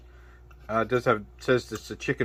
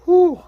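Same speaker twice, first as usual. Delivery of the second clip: hot exhale; 9 dB louder than usual. Real, not acted. Reacting to spicy heat.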